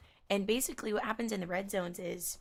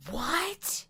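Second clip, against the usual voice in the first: surprised what; 8 semitones higher, 5 dB louder than usual. Real, not acted. Surprised by search result.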